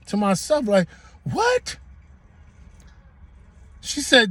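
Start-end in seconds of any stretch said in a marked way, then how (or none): 1.2-1.8 squeaky high disbelieving what; stunned high-pitched what; shocked what. Retold or imitated. Repeated inner shocked reaction.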